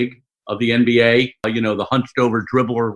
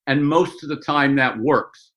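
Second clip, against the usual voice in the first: ordinary talk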